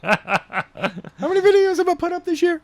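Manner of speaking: high laughing squeak of a question; laughing retort. Real, not acted. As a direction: laugh through the comeback. Teasing rebuttal, laughing.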